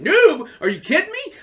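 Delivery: high, loud offended outburst; indignant disbelief. Retold or imitated. Answering the rival who called him a noob, indignant. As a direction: scoff at the insult, half laughing.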